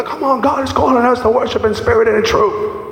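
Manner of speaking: high, pumped churchy sing-song, poking fun; mock hype preacher. Preacher imitates hollow church hype, high and pumped. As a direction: ham up the empty hype line. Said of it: "it sounds when you were".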